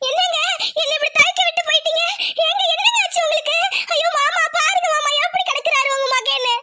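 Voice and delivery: high keening lament; wailing grief. Acted. Widow wailing over her husband's body, keening.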